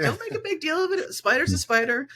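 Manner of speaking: casual shrug. Retold or imitated. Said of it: "very casual".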